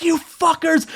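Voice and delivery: high-pitched mock fury; retold outrage. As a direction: act out bursting in to accuse them. Kicks door open, furious.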